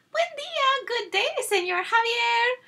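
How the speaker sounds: silly sisters chiming to the suitor; chiming sweetly. Acted. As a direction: chime it like a simpering girl. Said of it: "they chimed in unison".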